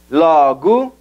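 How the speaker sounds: LOGO as "LAW-goo"; open o, reduced o. Shown as a demonstration. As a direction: open the first o in LOGO, shrink the last to u. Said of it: "Abre la primera O y reduce la segunda".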